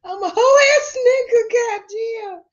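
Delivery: loud high shout of shock; excited shout. Real, not acted. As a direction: yell it out in disbelief. Shocked outburst at the crime video.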